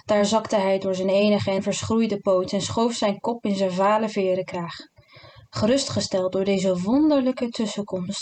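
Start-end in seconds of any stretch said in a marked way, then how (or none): none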